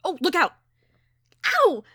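loud, high cry of alarm; sudden loud, high outburst; startled exclamation. A narrator's reading. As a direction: yelp out a warning, then in pain. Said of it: "I exclaimed and shook my hand".

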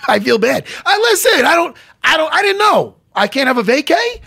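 high, loud mock-hurt pleading; mock whining protest. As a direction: plead loudly, pretending to be wounded. Mock protest about his vacation.

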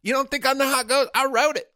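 high, loud, fast protest; indignant shouting. Acted. Songwriter insisting to a doubting friend, indignant shout. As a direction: shout it in rising outrage. Acted quarrel over who wrote it.

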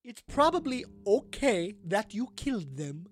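slow, lilting, high grande dame; theatrical ghost madam. Acted. Ghost excuses a visitor's killings, slow and theatrical. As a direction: reassure grandly, stretching the words.

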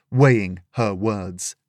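ordinary delivery, nothing marked

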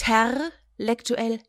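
intellektuell with the R, drawn out slowly; wrong version. Shown as a demonstration. Host mocking the wrong form slowly.

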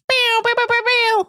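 high "bow bow bow" fanfare; fanfare noises. Shown as a demonstration. Podcast host hyping a classic impression, high mock fanfare. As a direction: sound a high, playful imitation horn fanfare.

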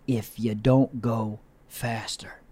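slow whisper of advice. Acted. Telling the gentlemen to slow down, whispered.

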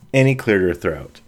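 ordinary delivery

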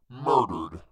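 loud, pointed correction in the hangman's voice; amiable hangman. A narrator's reading. Hangman correcting the narrator, amiable but emphatic. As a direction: correct them firmly, as the hangman.

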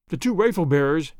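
ordinary delivery, nothing marked

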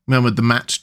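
ordinary delivery

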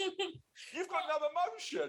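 mock-tragic teasing whine; mock dismay. Mock scolding Russ.

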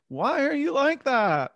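high, drawn-out comedian imitation; Jerry Seinfeld. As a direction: stretch the question in a high sitcom whine. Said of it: "Jerry Seinfeld is like".

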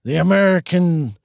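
lightly voiced presidential speech; a hint of a rousing president; Bill Pullman impression. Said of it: "that's my Bill Pullman".

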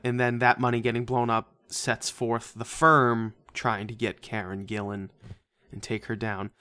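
usual speaking voice

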